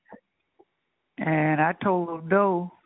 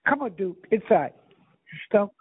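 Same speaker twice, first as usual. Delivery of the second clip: firm calls to a dog; ordering the dog inside; talking to a dog; 4 semitones higher than usual. Real, not acted. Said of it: "I'm talking to my dog".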